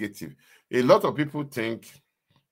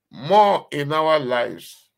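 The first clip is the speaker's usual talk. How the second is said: emphatic slow cry; 3 semitones higher, 7 dB louder, much slower than usual. Acted. Loud emphatic close.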